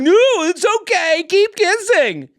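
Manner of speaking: high, loud drawn-out holler; egging on. Retold or imitated. Helped woman urging the couple onward, whooping holler. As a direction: imitate an oblivious onlooker cheering through the scolding.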